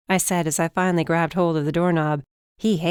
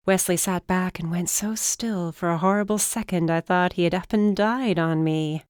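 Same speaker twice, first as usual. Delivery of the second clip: ordinary delivery, nothing marked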